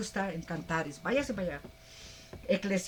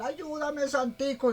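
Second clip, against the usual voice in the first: pleading idol worshipper; 6 semitones higher, slower than usual. Retold or imitated. Idol worshipper begging his idol.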